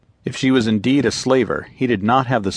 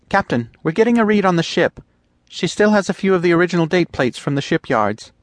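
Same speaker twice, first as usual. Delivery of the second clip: put-on higher, eager young officer voice; eager lieutenant; 10 semitones higher, 4 dB louder than usual. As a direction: report the find with keen urgency. Lieutenant reports sensor read.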